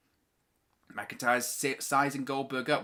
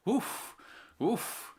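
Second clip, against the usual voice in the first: high relieved oof; 9 semitones higher, 3 dB quieter than usual. Real, not acted. Relief after tense match.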